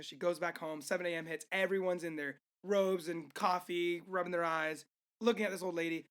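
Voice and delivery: ordinary talk, nothing marked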